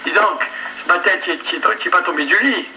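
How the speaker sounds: ordinary delivery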